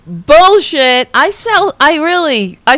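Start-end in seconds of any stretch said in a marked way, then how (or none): 0.1-1.1 loud, emphatic scoff; calling bullshit. Real, not acted. Host cutting off the lawyer's speech, loud and scornful. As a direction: punch it out in open disbelief.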